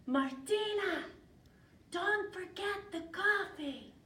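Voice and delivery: high, loud, stretched-out call. Acted. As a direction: holler a reminder from a hiding place. Grandmother calls from hiding.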